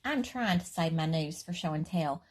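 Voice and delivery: ordinary talk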